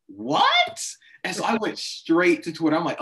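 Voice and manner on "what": very high, loud disbelieving "What?"; watching with his sister, retelling his shocked disbelief. Retold or imitated. Shoot way up in stunned disbelief. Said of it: "we're both like".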